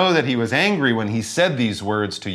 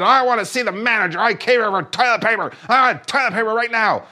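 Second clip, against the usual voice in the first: higher, fast entitled demand; angry Karen; 8 semitones higher, faster than usual. Retold or imitated. Customer demanding toilet paper from the store, mock outraged. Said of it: "Karen snapped".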